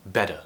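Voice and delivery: "better" with a D sound for the T; flapped T. Shown as a demonstration. Teacher shows other accents, "better" with a flapped T. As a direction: tap the tongue quickly, "better" like "bedder". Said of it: "T is actually replaced with a D sound".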